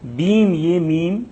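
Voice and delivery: بیم and میم said slowly, ending on a rise; single out the بیم ending with its میم; pronunciation focus. Shown as a demonstration. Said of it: "ہم تلفظ کر رہے ہیں". (بیم اور میم پر زور)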